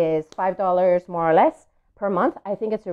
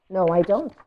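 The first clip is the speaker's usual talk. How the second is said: usual speaking voice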